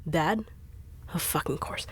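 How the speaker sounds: ordinary delivery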